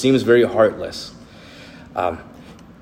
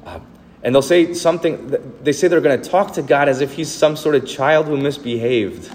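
normal talking voice

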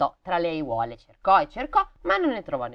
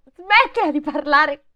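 high, louder sarcastic jab; mock scolding; 10 semitones higher, 8 dB louder than usual. Real, not acted. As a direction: tease the character. Mocking the heroine. (rimprovero sarcastico, acuto)

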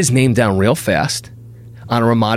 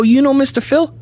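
much higher, bright and eager question; eager, friendly. Retold or imitated. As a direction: ask hopefully, all friendly. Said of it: "The tone changed".

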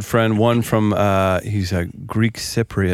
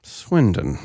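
disdainful Swindon; 2 semitones lower than usual. Retold or imitated. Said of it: "The guy goes".

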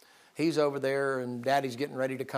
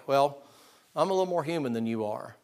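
ordinary talk

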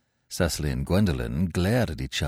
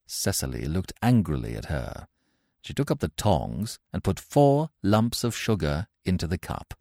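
ordinary delivery, nothing marked

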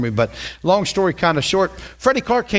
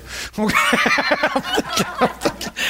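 high breathy laugh; 12 semitones higher than usual. Real, not acted. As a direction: burst out in a high, airy laugh. Laugh after daughter's answer.

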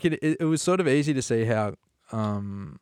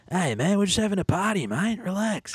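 much higher, easygoing mock reassurance; laid-back Aussie partier; 9 semitones higher than usual. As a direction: brush off the angry owner like it's nothing. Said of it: "just goes".

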